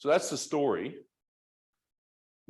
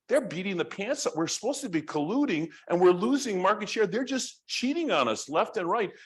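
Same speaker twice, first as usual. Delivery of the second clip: high-pitched retold grumbling; mock indignation at being cheated; aggrieved complaint; 8 semitones higher than usual. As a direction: imitate outraged complainers, voice pitched up. Acted complaint of aggrieved cartel members.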